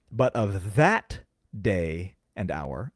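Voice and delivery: THAT drawn out long with a rising lift; slow right down and lean hard on "that"; stress on THAT. Shown as a demonstration. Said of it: "I have to put emphasis on the word "that".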